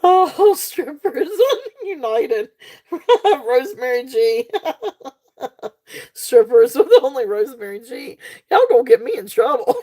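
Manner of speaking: words bubbling out through real laughter; laughing to tears. Real, not acted. Seller teasing viewers who'll get her in trouble, laughing helplessly. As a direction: keep cracking up as you speak. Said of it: "I'm gonna start crying again".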